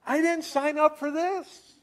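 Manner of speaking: high, loud exasperated cry; frustrated outburst. Acted. Overwhelmed mother crying out about her burden, high and loud. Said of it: "where she's just said".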